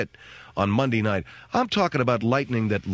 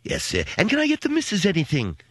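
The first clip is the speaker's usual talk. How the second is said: high, obliging butler offering fruit; mock posh servant voice; servile waiter voice; 5 semitones higher than usual. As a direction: play the fawning resort waiter. Playing the butler again.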